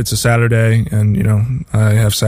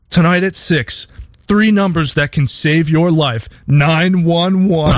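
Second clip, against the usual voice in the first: higher, slowing news-teaser read, last words stretched; 4 semitones higher, slower than usual. Retold or imitated. Quoting an old news break teaser to friends, in a higher, slow anchor voice. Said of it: "and it's like".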